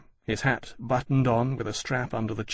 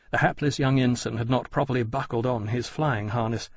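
ordinary delivery, nothing marked

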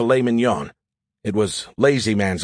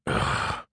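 soft, low exhaled ugh; disgusted groan; 7 dB quieter than usual. Acted. AI reacting to deadly plan, disgusted groan. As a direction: sigh out a quiet sound of disgust.

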